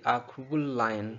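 "cruel lion" joined on one long L; linked L. Shown as a demonstration. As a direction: glide from "cruel" into "lion" without a break. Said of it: "ये हम पढ़ेंगे". ("cruel lion" बिना वक्फे के)

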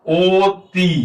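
অতি with a long, drawn-out ও at the start; ও-sound demonstration. Shown as a demonstration. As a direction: stretch অতি out slowly, opening on a rounded "o". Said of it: "তাহলে এটার উচ্চারণ হবে কি".

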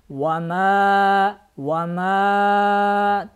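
"вама" with the final a stretched very long; sustained chanted a ending "вама"; long madd. Shown as a demonstration. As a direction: draw out the last vowel of "вама". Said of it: "надо тянуть на четыре и пять алифа".

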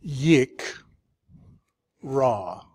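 "Yik", pause, "ra"; syllable by syllable. Shown as a demonstration. Teacher starting over after the doubled letter, "Yik, ra" split. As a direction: restart firmly on "Yik", leave "ra" separate. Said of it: "then you start over".

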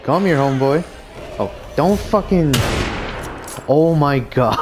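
loud, high, frantic taunts; frantic taunting. Real, not acted. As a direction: hype yelling mid-fight. Frantic taunting during fight.

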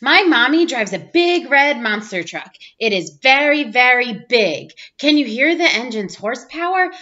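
ordinary delivery, nothing marked

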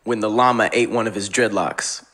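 ordinary talk, nothing marked

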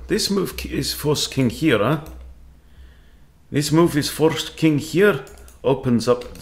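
mock meerkat-style Russian accent. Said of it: "Stop talking in that stupid bloody meerkat accent".

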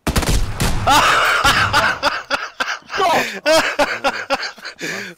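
long, loud, high-pitched fit of laughter. Real, not acted. Long laugh during the fight.

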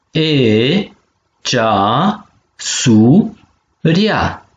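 cea, su, ria in three separate beats; syllable by syllable. Shown as a demonstration. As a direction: split it cea - su - ria, pausing between. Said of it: "So de silabe si". (pe silabe: cea, su, ria)

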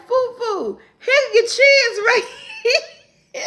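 high, slow, cracking up mid-sentence; laughing. Real, not acted. Showing her son the chair covers, laughing. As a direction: laugh through every word.

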